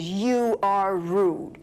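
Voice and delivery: each word slow and weighty; drawn-out, deliberate accusation; stern retold rebuke. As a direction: spell out the rebuke slowly, word by word. Officer's rebuke in retold argument.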